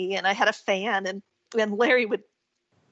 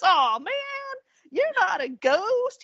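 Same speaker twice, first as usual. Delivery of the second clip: higher, drawn-out imitation of New Orleans speech; New Orleans accent; 9 semitones higher, slower than usual. Startled worker realizes she's no ghost, retold in New Orleans accent. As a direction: lightly imitate a New Orleans man, pitched up. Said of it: "this kind of really great, like, um, New Orleans accent".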